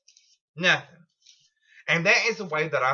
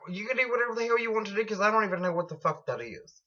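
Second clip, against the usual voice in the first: rattled off fast, a touch quieter; rushed offhand quote; 3 semitones higher, 4 dB quieter, much faster than usual. Retold or imitated. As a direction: toss it off quickly, like it's no big deal. Quoting herself to the drug guys.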